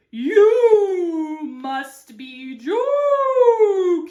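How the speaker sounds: very slow, high, loud hooting; hooting owl. Acted. Owl scoffing at the cactus's offer, hooting. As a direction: draw each vowel into a hoot.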